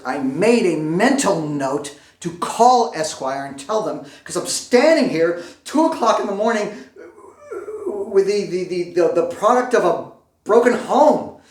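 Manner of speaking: high, loud, exasperated rant; worked-up indignation. Acted. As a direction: build to a stammering, high-pitched outburst. Acted outrage at failed tear gas pen.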